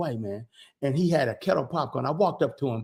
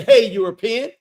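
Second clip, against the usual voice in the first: imagined call-out; 7 semitones higher, 7 dB louder than usual. Retold or imitated. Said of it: "I wanted to say".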